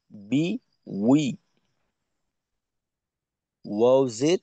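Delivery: বা জের বি, ওয়াও জের উই said slowly, each Arabic letter drawn out; Arabic letter drill. Shown as a demonstration. Teacher drilling a student on Arabic letters, slow careful recitation. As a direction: spell out বি and উই syllable by syllable, slowly. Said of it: "এখানেও পার্থক্যটা হয় উচ্চারণের জায়গা".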